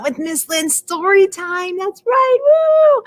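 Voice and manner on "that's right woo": high, loud whoop; bright storytime whoop; excited cheer. Real, not acted. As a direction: cheer them on.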